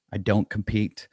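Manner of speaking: ordinary delivery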